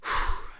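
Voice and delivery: a long audible exhale into the mic; deep breath out. Shown as a demonstration. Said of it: "take a deep breath sometimes when I go like this".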